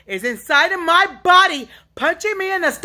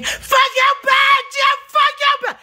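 raw screaming outburst; 12 semitones higher than usual. Real, not acted. Lashing out at unseen tormentors, shrieking. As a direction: shriek the curse out at full force. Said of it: "This yelling and screaming".

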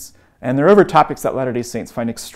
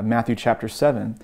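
ordinary talk

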